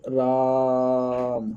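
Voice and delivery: long drawn-out राम, the आ held very long as if calling someone; teacher drawing out राम to show a prolonged vowel; calling out a name. Shown as a demonstration. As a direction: stretch राम out like calling across a distance. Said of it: "जैसे किसी को पुकारने में".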